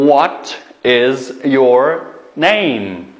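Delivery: What is your name, very slow and drawn out; slow model question. Shown as a demonstration. Teacher modelling the question slowly for pupils. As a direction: stretch each word for the learners. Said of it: "Deganda so'raymiz".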